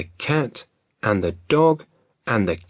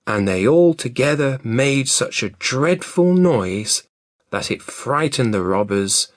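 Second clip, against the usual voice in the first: usual speaking voice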